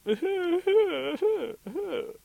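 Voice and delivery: very high, loud wailing; high-pitched repeated cries; imitated weird noise. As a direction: mimic a far-off creature crying in bursts. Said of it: "It sounds like this".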